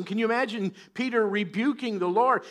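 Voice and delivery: ordinary talk, nothing marked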